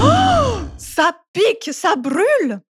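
high, loud ogre cry of pain; horrible cry. Acted. Ogre burnt by chili in sauce cries out in pain. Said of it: "Il pousse alors un cri horrible".

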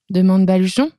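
ordinary delivery

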